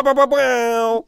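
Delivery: loud, slow, high bow bow horn; hype horn noise. Shown as a demonstration. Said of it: "I can do the bow".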